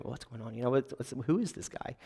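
soft, hushed muttering of onlookers; whispers in the room. Retold or imitated. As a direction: hush it like a worried bystander. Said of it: "You know, whispers going".

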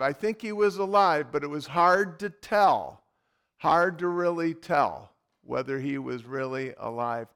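ordinary talk

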